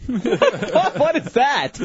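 high, laughing disbelief; baffled question through laughter. Real, not acted. Cracks up at a garbled message.

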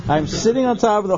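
excited. Real, not acted. Set the scene, raised pitch.

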